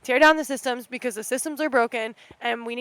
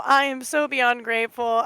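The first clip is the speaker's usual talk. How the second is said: normal talking voice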